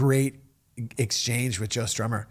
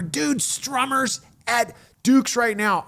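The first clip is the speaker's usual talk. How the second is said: high, slow, emphatic excitement; excited news; 9 semitones higher, slower than usual. Retold or imitated. Host tells his bass player about [name], high and slow. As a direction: drag it out, can't believe it.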